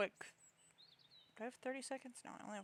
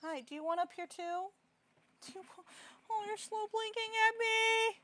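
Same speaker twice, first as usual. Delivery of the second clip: high, cooing pet voice; 12 semitones higher, 4 dB louder than usual. Real, not acted. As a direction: coax the cat sweetly. Cooing at cats.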